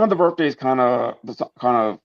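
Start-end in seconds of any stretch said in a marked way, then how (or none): none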